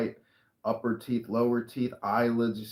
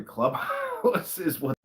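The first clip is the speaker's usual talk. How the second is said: laughing; 8 semitones higher, slower than usual. Real, not acted. Laughing through own remark.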